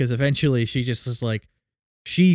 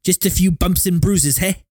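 higher-pitched South African villain; South African accent; 5 semitones higher than usual. Acted. Imitating the movie villain's goon talk, South African accent. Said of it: "The South African accent is".

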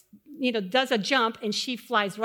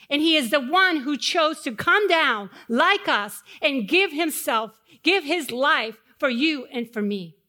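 rising, louder preaching cadence; 2 semitones higher, 4 dB louder than usual. Acted. Pastor preaching to the church, building fervor. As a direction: preach it out to the congregation.

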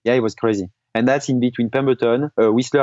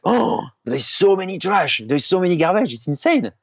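shocked disbelief; 6 semitones higher than usual. Retold or imitated. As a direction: gasp at the mess on the roadside. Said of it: "and I was like".

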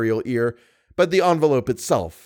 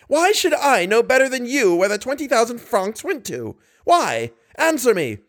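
a mild thundering demand; roaring; 10 semitones higher than usual. A narrator's reading. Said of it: "In a voice growling and rolling like thunder, he roared".

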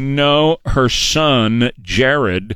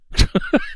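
short breathy laugh; a helpless little laugh; amused chuckle; 12 semitones higher than usual. Real, not acted. Amused at the fake accent.

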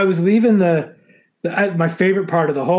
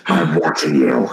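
deep, slow menacing creep; low, slow threat from a stalker; creepy axe murderer voice; 10 semitones lower than usual. Pretend. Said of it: "a creepy axe murderer".

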